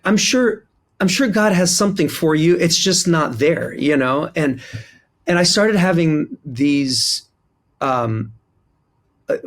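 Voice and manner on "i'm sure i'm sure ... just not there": higher, sugary patronizing mock; condescending reassurance. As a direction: imitate the administrator brushing him off sweetly. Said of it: "this look on her face, like".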